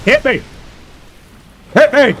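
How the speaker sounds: impatient high-pitched dare; goading call. Real, not acted. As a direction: taunt the enemy into striking. Goading the game boss to attack.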